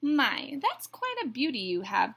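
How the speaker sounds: loud, admiring bird call; calling across. A narrator's reading. Bluebird admires the egg from across the river, calling. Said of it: "called a bluebird from across the river".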